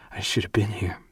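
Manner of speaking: soft unvoiced whisper, falling; quiet whispered echo; whispered regret repeated. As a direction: whisper it again, settling.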